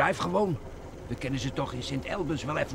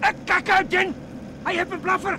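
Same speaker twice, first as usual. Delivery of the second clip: panicked yell, much higher and louder; alarmed shout; 4 dB louder than usual. Acted. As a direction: scream a warning to your partner in terror. Gangster sees gun in chase. (paniekerige schreeuw)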